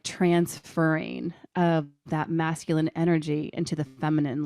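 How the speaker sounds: usual speaking voice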